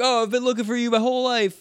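high-pitched, overeager greeting; mock gushing kid. Kid greeting the old man he has sought, mock gushing. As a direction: imitate an awestruck kid meeting his idol.

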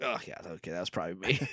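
low, soft sheepish admission; sheepish mumble. Retold or imitated. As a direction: own up under your breath, lightly acted. Acts out sheepish character smelling breath.

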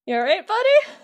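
high, odd cartoon voice asking if he's okay; concerned sidekick. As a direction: pitch way up into a goofy sidekick voice. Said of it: "They're just like".

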